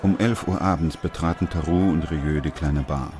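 even, measured narrator read; story narration. A narrator's reading. Narrator sets the scene for listeners, steady storytelling. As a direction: tell it like a radio play narrator.

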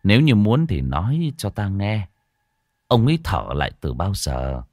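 low, solemn monk's voice; grave old monk. Acted. Monk telling the wife a demon is inside, low and grave. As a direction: speak low and heavy, like a monk warning of evil.

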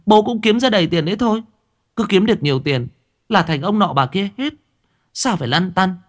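put-on high, quick young girl talking back; defiant daughter. A narrator's reading. Daughter talking back to father, high defiant voice. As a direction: argue back, cheeky and sure.

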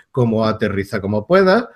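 usual speaking voice

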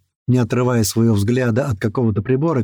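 ordinary delivery, nothing marked

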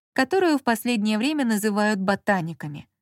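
ordinary delivery, nothing marked

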